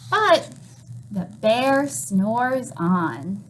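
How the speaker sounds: slow, drawn-out storybook refrain; sleepy refrain. A narrator's reading. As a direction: let the line drift off like the bear sleeps. Refrain read to children.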